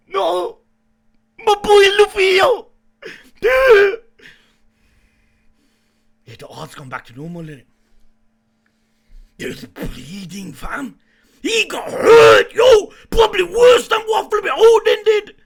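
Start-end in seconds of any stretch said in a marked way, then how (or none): 0.1-4.0 loud dismayed cry; shocked shout. Real, not acted. Shock at hero hurt. 11.4-15.3 distressed shout. Real, not acted. Upset hero is hurt.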